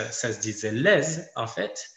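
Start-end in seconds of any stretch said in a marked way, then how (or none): 0.7-1.0 "les" with a sounded Z at the end; final Z pronounced. Shown as a demonstration. Teacher showing past pronunciation, "les" ending in audible Z. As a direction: say "les" as "lèze", the old way. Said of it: "prononcer ce z final".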